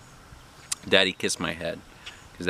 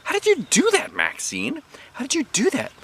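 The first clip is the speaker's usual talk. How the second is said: much higher excited questions; can't believe the trick; higher wondering little girl; amazed. A narrator's reading. Junie B amazed by Maxine.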